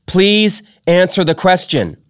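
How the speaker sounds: high, loud stern demand; stern loud order; stern mother. Acted. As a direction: insist he answers. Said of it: "she said sternly".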